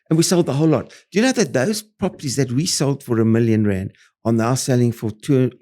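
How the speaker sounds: ordinary talk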